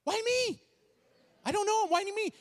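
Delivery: high-pitched, flustered objection; acted panicked protest. Pastor handed the phone by husband, high panicked protest.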